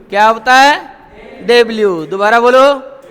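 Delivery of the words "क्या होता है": ordinary talk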